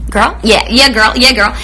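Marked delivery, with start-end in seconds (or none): none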